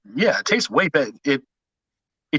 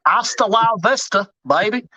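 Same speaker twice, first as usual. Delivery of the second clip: Terminator line; 6 semitones higher than usual. Retold or imitated.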